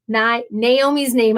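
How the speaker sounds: ordinary talk, nothing marked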